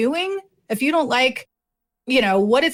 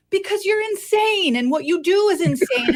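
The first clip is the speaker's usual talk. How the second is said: higher-pitched, emphatic comeback; mock exasperated retort; 7 semitones higher than usual. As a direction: fire back at the imagined coworkers. Acted retort to coworkers.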